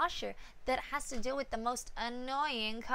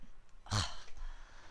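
long unvoiced tired sigh; weary breathy sigh; 8 dB quieter than usual. Real, not acted. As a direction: let it all out, worn out. Tired sigh before bed.

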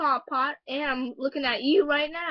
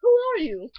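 startled question; 9 semitones higher, 7 dB louder than usual. Acted. Startled at the stranger.

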